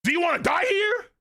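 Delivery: high, strained anime yell; hammed-up high-pitched rebuke; scolding shout. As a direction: shout it as an outraged challenge. Acts out old man scolding Luffy.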